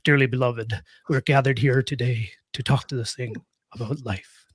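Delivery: a light, half-spoken take on the [name] intro, trailing off at the end; [name] song intro. Retold or imitated. Podcast guest jokingly slipping into a lightly voiced [name] intro. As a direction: toss off a playful hint of [name]'s sermon opening. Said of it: "not gonna bust into a [name] song".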